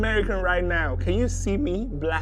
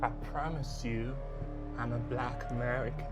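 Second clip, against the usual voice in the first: soft and low, begging to be believed; quiet pleading; 7 semitones lower, 7 dB quieter than usual. Acted. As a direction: hush it down and plead. Scammer pleading after exposure.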